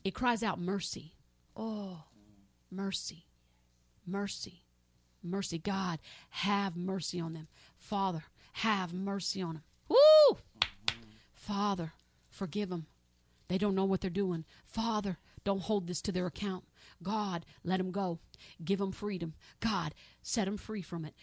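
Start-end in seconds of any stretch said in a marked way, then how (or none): 1.5-9.6 soft, low plea for mercy; a preacher interceding quietly for mercy; pleading prayer. Real, not acted. 9.9-10.4 sudden loud high whoop; a preacher's ecstatic whoop mid-prayer; high woo. Real, not acted. Preacher's outburst. 11.3-21.1 soft, low plea for mercy; a preacher interceding quietly for mercy; pleading prayer. Real, not acted.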